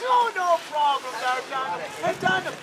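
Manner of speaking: lilting granny with a prying question; Grandma Rose. Acted. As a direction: needle him sweetly as an Irish gran. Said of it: "Grandma Rose comes up to me and says".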